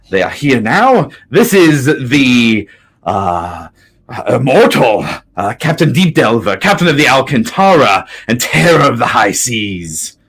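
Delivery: loud, proud announcing; grand proclamation. Acted. NPC proclaims captain's titles.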